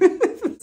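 short high-pitched giggle; quick high laugh; real giggle. Real, not acted. Laughs after scolding.

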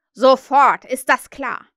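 loud barked captain's command; shouted order. Acted. Peter as Hook shouts at the pirates, loud command. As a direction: snap the order at them. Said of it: "rief Peter".

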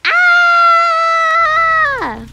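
sudden high loud shriek; excited scream. Real, not acted. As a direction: shriek out in delight.

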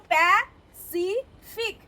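Pacific slowly in three separate beats; split into syllables. Shown as a demonstration. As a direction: sound out প্যা, সি, ফিক with clear gaps in Pacific. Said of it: "তিনটে syllable".

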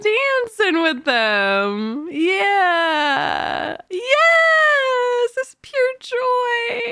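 high, loud tearful cry of joy; joyful sobbing. Real, not acted. Fan crying with joy at the dancing, sobbing. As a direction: overcome with happy tears.

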